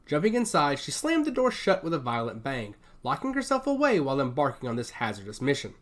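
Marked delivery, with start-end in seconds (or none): none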